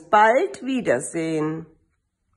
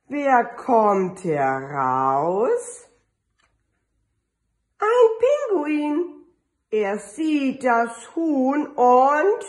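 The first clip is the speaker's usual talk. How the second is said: put-on lively, drawn-out read-aloud for little kids; storybook reading; 6 semitones higher, slower than usual. Teacher reading an Easter book to her kindergarten kids, lively and drawn out. Reads picture book to children.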